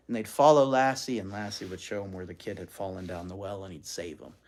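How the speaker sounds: ordinary delivery, nothing marked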